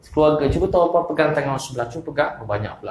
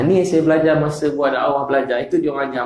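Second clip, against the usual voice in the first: ordinary talk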